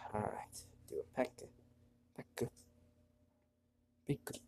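normal talking voice

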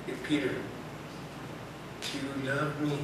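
Jesus softly asking Peter again; gentle asking. Retold or imitated. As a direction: ask like a patient friend.

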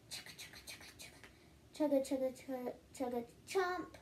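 gobbling noises. Acted. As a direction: make quick eating noises. Gobbling pie sound.

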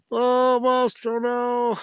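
high, held-out cry of almost; high, drawn-out mock dismay; playful near-miss cry. Real, not acted. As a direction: call it out high as the catch just fails.

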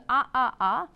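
short loud cries of pain; mock pained cries. Lecturer acting the hero crying out in pain. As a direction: cry out in pain, acted. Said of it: "So he cre-, screams".

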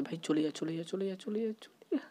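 will it away under your breath; tense hush. Real, not acted. Hiding from granny nearby.

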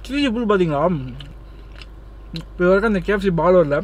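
chewing while talking; eating pizza while speaking; mouth full. Real, not acted. Seen 0: Food visible in mouth at 0.4; chewing continues while talking throughout.

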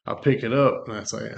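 normal talking voice

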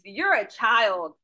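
ordinary delivery

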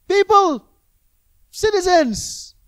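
loud, high pleading call; shouting. Retold or imitated. Lot calling out to the mob, loud high shout. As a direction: call out over a crowd, stretching citizens slowly. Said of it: "He's shouting so everyone can hear".

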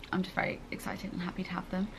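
breathless little secret; excited whisper. Real, not acted. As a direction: whisper it, giddy and quick. Excited whisper about shoes.

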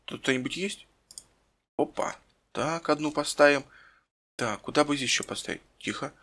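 hushed sneaking voice; whispering while hiding. Pretend. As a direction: keep it down, sneaking. Sneaking, planting dynamite.

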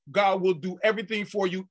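ordinary talk

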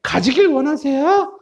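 high, slow dialogue character voice; high-voiced questioner. Teacher acting A asking what you want, high character voice. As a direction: ask way up high, spacing the words.

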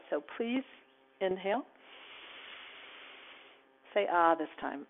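calm guided relaxation cue; guided meditation. Acted. Guides relaxation and loving kindness.

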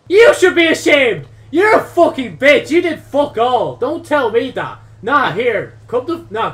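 high, loud mock tirade; angry shouting. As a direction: yell back in hammed-up outrage. Shouts back at game character.

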